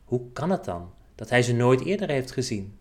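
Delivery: plain reading voice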